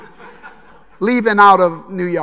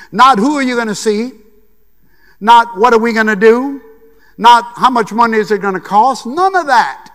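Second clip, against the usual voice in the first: louder, higher, hammering each question; indignant crescendo ending high on none of that; emphatic outrage; 6 semitones higher, 8 dB louder than usual. Real, not acted. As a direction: pound out every not. Indignant rhetorical list.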